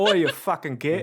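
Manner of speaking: loud, hammed-up Cockney outburst; bad Cockney accent. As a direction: call out in a terrible Cockney accent. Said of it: "really bad Cockney accent".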